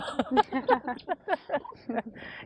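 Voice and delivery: high, rippling laugh; self-mocking laugh. Real, not acted. Interviewee admitting she was late, laughing. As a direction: laugh at your own blunder. Laughs at her own lateness.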